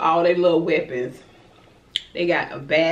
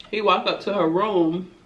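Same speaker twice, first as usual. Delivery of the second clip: usual speaking voice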